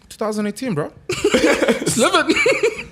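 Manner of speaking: high and loud, laughing through it; tossing it off with a big laugh; slow, giggling carefree line; laughing quote. Retold or imitated. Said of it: "the only reaction comes out of me is like".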